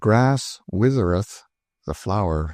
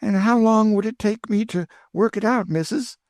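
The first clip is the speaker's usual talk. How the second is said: put-on much higher, thrilled old cook; Aunt Chloe delighted; 13 semitones higher than usual. A narrator's reading. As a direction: burst with joy, high and eager. Chloe voiced by the narrator.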